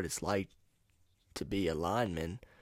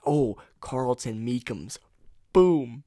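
excited realization; 5 semitones higher, 11 dB louder, much slower than usual. Real, not acted. Suddenly remembers a guest.

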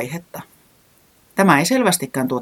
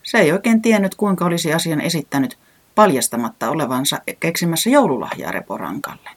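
ordinary delivery, nothing marked

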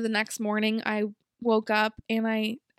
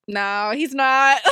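slow, much louder, higher dad; dad's confession; 3 semitones higher, 10 dB louder, much slower than usual. Retold or imitated. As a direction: drag it out like a cornered dad. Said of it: "he was like".

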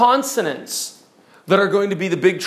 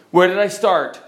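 ordinary talk

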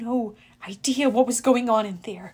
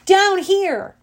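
high, loud shout; loud, raised-pitch yell; yelled call; 7 semitones higher, 6 dB louder than usual. A narrator's reading. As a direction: call out to draw attention. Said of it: "I yelled".

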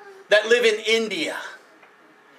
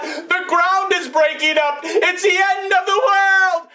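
rising panic, as if the world is ending; frightened rabbit yelling the alarm; panicked rabbit; 7 semitones higher than usual. Acted.

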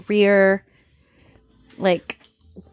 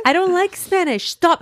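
whiny son quote; 9 semitones higher than usual. Retold or imitated. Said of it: "Is that what he said?".

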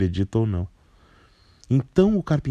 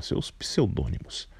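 usual speaking voice

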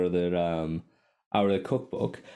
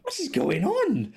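laughing disbelief; 5 semitones higher, 6 dB louder, slower than usual. Retold or imitated.

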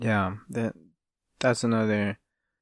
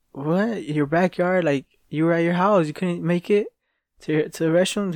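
raised pitch, mock disbelief; incredulous question; 6 semitones higher than usual. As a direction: play someone who can't believe it. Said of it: "they asked him".

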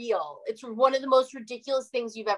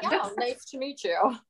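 polite brush-off; 3 semitones higher than usual. Retold or imitated. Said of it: "And they're like".

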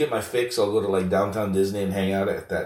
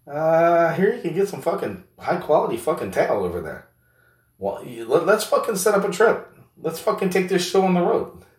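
high-pitched mocking buddy; 8 semitones higher than usual. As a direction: play a rowdy buddy ribbing his pal in a voice well above your own. Second character plans a trip.

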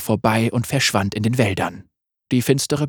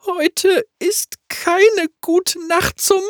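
viel höher und langsam, zitternd vor Angst; ängstlich hoch; much slower than usual. Acted. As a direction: sie ringt um Fassung. Magga frightened, very high. (Magga spricht ängstlich und hoch)

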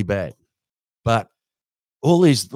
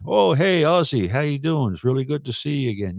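higher-pitched, friendly American impression; put-on genial American greeting; Arnold Palmer; 5 semitones higher than usual. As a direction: imitate a golfer delighted to greet someone. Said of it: "Arnie'd come in and he'd walk past and say".

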